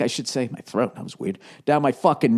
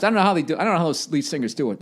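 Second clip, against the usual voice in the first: ordinary talk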